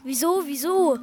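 irritated echo of the question; slightly annoyed. A narrator's reading. Pepsi echoes Pupsi's timid question, slightly annoyed. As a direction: throw the question back, mildly annoyed. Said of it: "Pepsi war ein bisschen ärgerlich".

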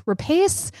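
Rapace said as "ra-PACE", high and questioning; guessed pronunciation. Shown as a demonstration. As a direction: try "Rapace" to rhyme with "pace", unsure. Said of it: "I still don't know how to say".

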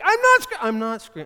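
very loud, high yell that cuts off; mock screaming denial. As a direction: scream that you aren't screaming. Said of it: "I'm like".

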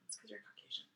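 breathy whispered jab; teasing whisper. Real, not acted. Whispering a teasing jab to her friend about taste. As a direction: whisper the tease.

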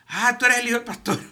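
high, loud sing-song taunt; mock teasing. Pastor mimics teachers taunting his son, high mocking. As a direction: taunt him like a smug teacher.

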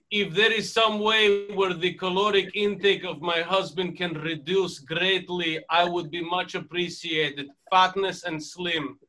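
formal broken English in a light mock foreign accent, slowing down near the end; a touch of foreign accent, stiff and formal. Guest continuing the email parody, in a light mock foreign accent. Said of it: "if they did speak with that accent".

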